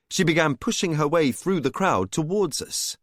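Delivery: ordinary delivery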